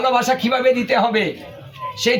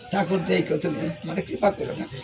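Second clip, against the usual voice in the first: whispered plea to the deity; hushed prayer; 8 semitones lower, 8 dB quieter than usual. Retold or imitated. Devotee murmuring a plea to Thakur, whispered. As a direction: breathe the prayer under your breath, barely voiced.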